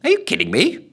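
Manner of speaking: high incredulous ghost; muttered. A narrator's reading. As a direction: scoff in disbelief. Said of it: "muttered the ghost".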